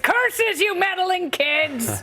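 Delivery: high, slow cartoon villain rant; sputtering foiled villain. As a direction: shake your fist like a foiled cartoon villain. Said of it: "Nancy Pelosi is shaking her fist".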